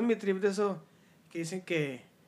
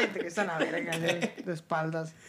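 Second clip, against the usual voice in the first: normal talking voice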